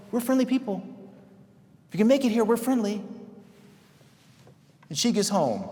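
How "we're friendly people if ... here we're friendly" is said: mock friendly church. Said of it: "we're out there going".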